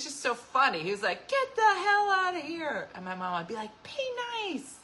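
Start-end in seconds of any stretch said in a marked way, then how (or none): 1.3-2.9 slow, much higher, louder mock-gruff dad; dad's gruff line. Dad greeting a boyfriend he likes, slow mock gruffness. 3.8-4.8 much higher, thin mom voice; a quick high scold like a mom; mom's warning. Retold or imitated. Mom telling dad to be nice, high scolding.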